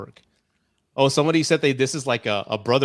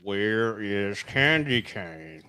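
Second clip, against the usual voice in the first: very slow, drawn-out call; lingering movie-villain impression; creepy villain quote; 2 semitones lower, much slower than usual. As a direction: stretch each word out like a stalker. Joins in quoting the movie villain.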